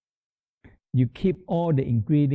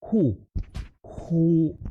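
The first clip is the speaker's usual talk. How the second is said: spleen who sound; 5 semitones higher than usual. Shown as a demonstration. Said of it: "or who sound".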